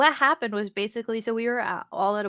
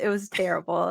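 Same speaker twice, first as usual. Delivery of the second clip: ordinary talk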